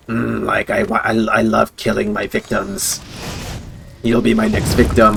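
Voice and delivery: lightly put-on creepy murderer voice; mock psychotic killer. Kid randomly talking like a killer, psychotic. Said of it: "really, like, psychotic shit".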